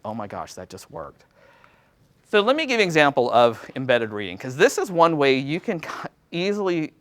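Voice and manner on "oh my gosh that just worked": hushed, low aside of disbelief; acted-out awe, much softer and lower; quiet amazement. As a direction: marvel softly to yourself. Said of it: "walk away in a teaching high".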